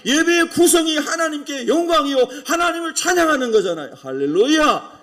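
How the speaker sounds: loud, high-pitched sermon delivery; forceful preaching. Real, not acted. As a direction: preach at full force to the congregation.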